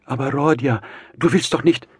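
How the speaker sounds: high, loud, frightened mother; startled, high-pitched disbelief; alarmed. A narrator's reading. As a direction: protest in rising alarm. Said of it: "fing Pulcheria Alexandrowna erschrocken an".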